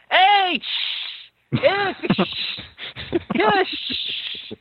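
high-pitched mock sneezes; explosive sneezes trailing into hiss; acted sneezes. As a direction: burst into a fake sneezing fit. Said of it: "my awesome sneeze rendition".

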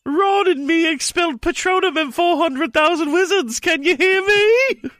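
very high, far squeaky voice, laughing, slowing; cartoon squeal dissolving into laughter; protesting. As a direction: wail it out in falsetto. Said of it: "Ron protested".

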